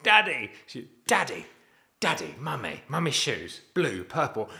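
put-on high, loud little girl calling Daddy; father imitating his little daughter calling Daddy; imitating toddler. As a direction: play a toddler shouting words with glee. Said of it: "she's like".

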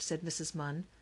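plain reading voice

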